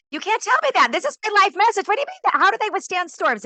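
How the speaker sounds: high, loud, indignant disbelief; retold disbelief. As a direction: protest as if your life message were just overturned. Retold disbelief at the tour guides.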